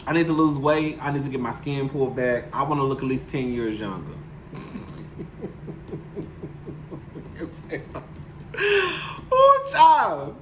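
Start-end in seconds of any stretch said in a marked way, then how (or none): 4.2-9.3 a soft laugh with tears close behind it; shaky half-laugh, half-cry; breathy teary laugh. Real, not acted. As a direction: laugh quietly through rising emotion. Emotional laugh on her dreams.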